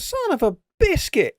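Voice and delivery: high, slow, cartoonish exclamation; mock curse.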